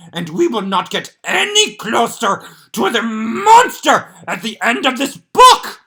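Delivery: a panicked puppet monster begging the reader; Grover voice. Acted. Grover nailing the pages.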